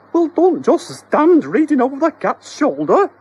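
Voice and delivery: very high, loud splutter; wife snapping at husband. Acted. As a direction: sputter in irritation. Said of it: "spluttered Missus Doherty".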